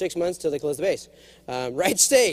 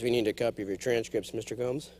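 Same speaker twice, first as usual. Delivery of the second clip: dry clerk voice; 10 semitones lower, 9 dB quieter than usual. Retold or imitated. Quoting office clerk dryly.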